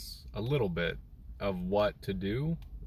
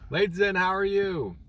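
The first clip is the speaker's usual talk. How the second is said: high, loud happy hello; cheerful greeting; 11 semitones higher, 6 dB louder than usual. Real, not acted. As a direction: greet a friend brightly. Greeting viewer in chat.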